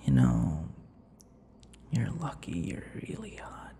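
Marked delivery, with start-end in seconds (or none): 1.9-3.7 soft teasing whisper; flirty whisper. Acted. Vampire teasing the captive, flirty whisper. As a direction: lean in and whisper the compliment.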